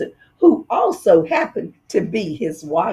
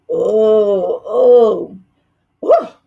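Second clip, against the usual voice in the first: louder rising groans of disgust; 5 dB louder, much slower than usual. Acted. Dentist inside the fox's mouth, groaning at the stench. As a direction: groan at the awful smell.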